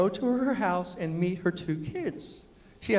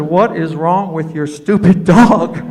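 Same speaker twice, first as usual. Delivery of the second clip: loud, slow exasperated complaint; 3 semitones lower, 14 dB louder, slower than usual. Retold or imitated. Complaining to Karen about the smelly dog, loud and slow. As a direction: throw it out fed up.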